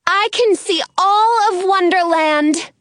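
high, thrilled call; excited shout. A narrator's reading. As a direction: shout the view down to friends. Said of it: "giant-necked Penny shouts".

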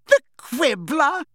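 slow high cackle through the words; cackling. A narrator's reading. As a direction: laugh out the name in scorn. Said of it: "she said, cackling".

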